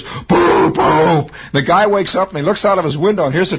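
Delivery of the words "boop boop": mock train horn, loud and high; train horn imitation. Storyteller mimics the train horn at the room, loud toot. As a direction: honk it like a locomotive. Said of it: "And he goes".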